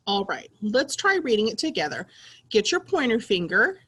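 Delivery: usual speaking voice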